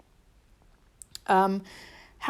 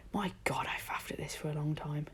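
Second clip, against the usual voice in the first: usual speaking voice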